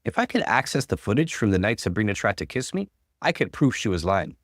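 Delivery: ordinary delivery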